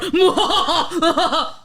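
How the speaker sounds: loud high laugh. Real, not acted. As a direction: burst out laughing. Laughs after impression.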